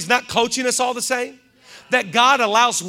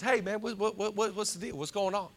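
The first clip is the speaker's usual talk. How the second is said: hushed puzzled brother; 10 dB quieter than usual. Acted. Brother quietly asks servant what's happening, hushed confusion. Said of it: "he says to one of the servants".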